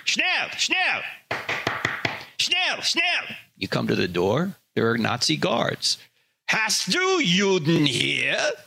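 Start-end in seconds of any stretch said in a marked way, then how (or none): 0.0-3.4 barking German guard, high and harsh; movie villain german accent. Retold or imitated. Nazi guards knocking at door. 6.5-8.5 slow, menacing German guard voice; movie villain german accent. Retold or imitated.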